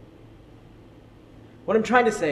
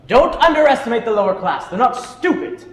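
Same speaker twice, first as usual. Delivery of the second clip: louder, higher, with a laugh; laughing defence; 5 semitones higher, 6 dB louder than usual. Acted. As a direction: laugh off the insult while pushing back. Teacher defends lower class.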